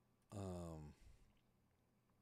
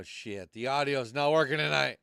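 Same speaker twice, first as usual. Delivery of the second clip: ordinary delivery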